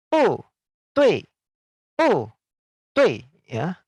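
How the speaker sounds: "bu, tui" both falling sharply; fourth-tone bu. Shown as a demonstration. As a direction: hit "bu" and "tui" each with a steep fall. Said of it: "Dua nada empat digabung".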